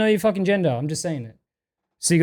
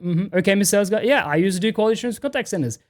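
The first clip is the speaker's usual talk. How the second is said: ordinary talk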